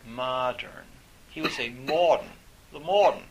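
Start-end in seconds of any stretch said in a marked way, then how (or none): 1.7-2.3 put-on "modern" as "mawd'n" in a New England accent, louder; mock New England accent, saying "modern" with no r, "mawd'n". Lecturer imitates professor's "modern", heavy New England accent. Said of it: "horrible New England accent". 2.7-3.2 put-on "the modern" as "the mawd'n", loud New England accent. Lecturer mimics senior professor, loud New England accent. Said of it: "horrible New England accent".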